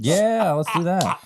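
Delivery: high, slow, gleeful; excited. Real, not acted. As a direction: draw it out with glee. Joking about pirate hats.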